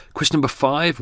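normal talking voice

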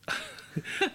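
shy embarrassed laugh. Real, not acted. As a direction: laugh off the praise. Guest embarrassed by praise.

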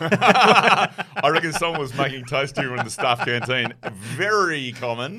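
chuckling through the joke; laughing. Real, not acted. Laughing after the fire drill.

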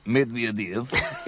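lightly put-on Russian accent; a hint of comic Russian on the name. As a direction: slip briefly into the mock-Russian voice. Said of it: "unless you say it in a Russian accent".

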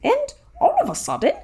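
high-pitched, hammed-up British character voice; British accent. Summarizing the book for the host, in character with British accent. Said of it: "I'll do the accent".

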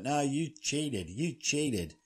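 high, drawn-out accusation; slow, high-pitched aggrieved complaint; mock indignant protest. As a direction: play a whining sore loser. Voices sore loser accusing winner.

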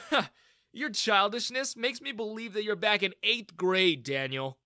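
smug, teasing taunt; young man smirking at his childish tormentor. Acted. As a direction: needle the bully with a smirk. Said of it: "I smirked".

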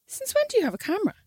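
shocked question. Retold or imitated. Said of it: "And I went".

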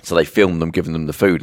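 ordinary talk, nothing marked

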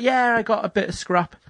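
hammed-up little victory cry; mock celebration. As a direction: celebrate a measly prize in a high voice. Retells celebrating a tiny reward.

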